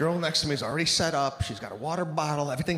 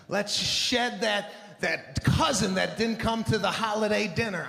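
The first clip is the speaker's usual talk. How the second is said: over-happy spin instructor. Retold or imitated. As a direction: hype the class like a too-cheerful coach. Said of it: "way too happy".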